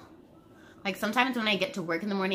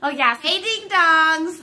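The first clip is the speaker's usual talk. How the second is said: high, loud, drawn-out hello; loud sing-song greeting; 8 semitones higher, 9 dB louder than usual. Real, not acted. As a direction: belt the greeting out long and high. Restarts intro louder.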